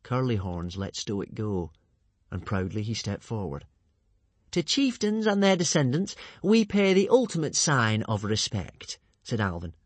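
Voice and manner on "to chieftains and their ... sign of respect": grand villain. Acted. Alvin's courtly villain speech.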